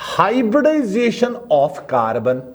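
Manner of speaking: the heading read word by word, slowly; slow dictation. Shown as a demonstration. Teacher dictates a heading for notes, slowly. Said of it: "dictation continues".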